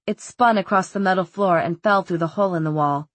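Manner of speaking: usual speaking voice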